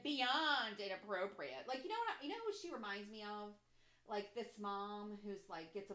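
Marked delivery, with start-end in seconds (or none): 0.0-1.7 BEYOND stretched out slow and loud; mocking emphasis. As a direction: lean hard and long on "Beyond". Mocking the headline's wording.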